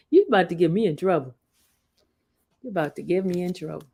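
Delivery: ordinary delivery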